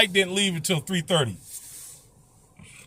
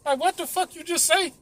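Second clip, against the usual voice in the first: baffled; 11 semitones higher, 4 dB quieter than usual. Real, not acted. Baffled after garbled announcement.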